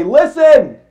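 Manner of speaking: a sharp loud call of Listen!; Jesus calling a crowd to listen. Retold or imitated. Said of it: "he'd say".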